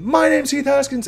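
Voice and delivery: high, loud self-introduction to a crowd; loud announcement. Pretend. YouTuber introducing himself to the mall crowd, loud. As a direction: announce your name across the mall. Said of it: "And give 'em".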